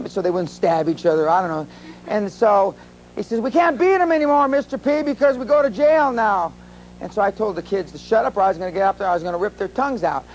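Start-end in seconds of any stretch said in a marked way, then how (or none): 3.4-6.5 high-pitched mock complaint; acted protesting coach. Gym coach answering the speaker about noisy kids, high mock protest. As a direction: voice a worked-up coach, pitched well up.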